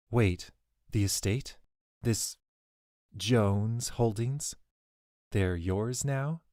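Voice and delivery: slow, halting questions; slow disbelief. A narrator's reading. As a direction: piece it together word by word. Allie slowly puzzling it out.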